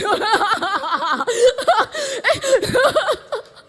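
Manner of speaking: high, loud burst of laughter; big laugh. Real, not acted. Laughing at own protest to God, high and loud. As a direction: crack up completely.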